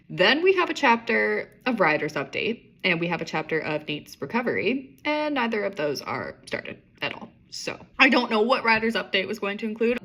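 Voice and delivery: plain reading voice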